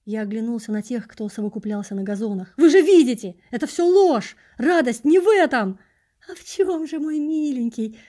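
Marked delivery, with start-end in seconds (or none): 2.6-5.8 high, very loud appeal to the crowd; frantic yelled protest; desperate shouting. A narrator's reading. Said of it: "изо всех сил закричал я". 6.3-8.0 high, cloying croon; syrupy predator coo; tender, quivering hunter. A narrator's reading. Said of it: "Ласково трепеща".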